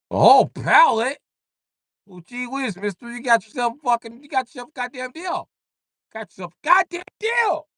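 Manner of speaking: very high, squeaky gee-whiz yokel; high country-boy voice delighted by the offer; squeaky thrilled hillbilly. Acted. Eager redneck accepting deal.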